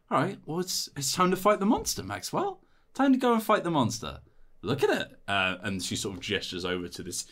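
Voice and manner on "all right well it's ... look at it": high-pitched, coaxing pep talk; rallying companion. Acted. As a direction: urge the hero on in a high voice. Companion sends hero at the monster.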